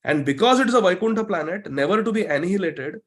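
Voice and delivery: slow, emphatic reading with lifted pitch; measured, declarative teaching recitation; scripture read aloud. Real, not acted. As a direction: read it out to the class phrase by phrase. Reads English commentary to the class.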